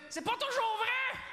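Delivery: high, drawn-out mock-outraged cry; shouted punchline. Comedian reviving his old country gala joke, high indignant shout.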